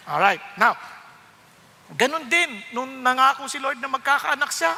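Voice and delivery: ordinary delivery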